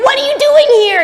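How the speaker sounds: put-on high, loud teasing older brother; mock big brother yell. Older brother scolds David, high loud mock yell. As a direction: shout it like a bossy older brother catching his kid brother.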